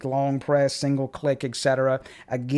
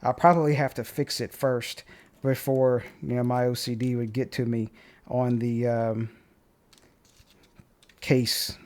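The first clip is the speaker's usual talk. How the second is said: ordinary talk, nothing marked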